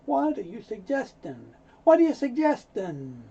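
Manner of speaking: offended tone. Shown as a demonstration. Teacher showing the tone of someone offended, affronted. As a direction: demonstrate taking offence at a suggestion. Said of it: "When somebody uses that tone".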